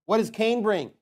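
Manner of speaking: ordinary delivery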